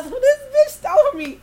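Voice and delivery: high, loud furious cry; angry mom. Retold or imitated. Mother shouting about the friend who stole, furious. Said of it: "My mama was mad as fuck".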